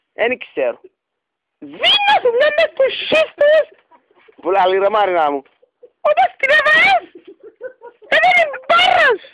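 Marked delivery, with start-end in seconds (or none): 1.6-3.7 mock shriek. Caller acting out his dream to the girl, hammed-up high shriek. 6.0-9.2 much higher, louder, slowed laughing cry-shout in a put-on voice; hammed-up wailing shout. Caller acting out the dream's crash, hammed-up laughing wail.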